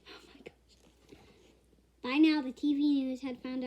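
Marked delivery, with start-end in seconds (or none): 0.1-0.8 breathless whisper; whispered shock. Real, not acted. Reader reacting to the story in a shocked whisper. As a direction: whisper it in disbelief.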